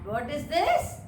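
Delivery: ordinary talk, nothing marked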